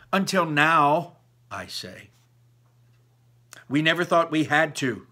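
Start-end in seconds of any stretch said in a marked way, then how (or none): 0.1-1.2 slow, loud, pointed; pointed retort. Acted. Daughter retorting to her father, slow and loud. 3.7-5.1 sudden outburst, louder and higher; exploding. A narrator's reading. Mother exploding at her daughter, loud and higher. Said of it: "my mother explodes".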